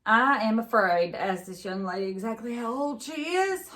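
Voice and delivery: wry sheriff. A narrator's reading. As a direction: sheriff joking to the deputy. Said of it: "the sheriff said to the deputy".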